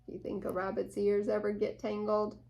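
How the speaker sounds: usual speaking voice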